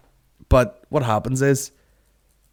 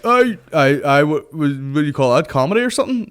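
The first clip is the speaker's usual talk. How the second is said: louder, higher, stammering heckler; raised-voice stumbling mock heckle; imitating heckler; 5 semitones higher, 4 dB louder than usual. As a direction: mimic a punter butting in, tripping over the words.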